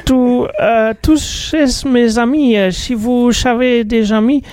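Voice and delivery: high, put-on Icelandic accent, half Portuguese; hammy Icelandic accent sliding into Portuguese. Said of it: "mon accent islandais ressemble plus un peu portugais".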